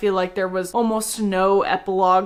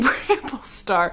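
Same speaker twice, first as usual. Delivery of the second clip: laughing; slower than usual. Real, not acted. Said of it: "my brain is still going".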